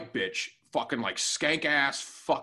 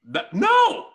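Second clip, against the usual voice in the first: loud, high outburst; indignant refusal; 5 semitones higher, 6 dB louder than usual. Real, not acted. Told to pray for the woman, indignant refusal. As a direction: explode at the very suggestion.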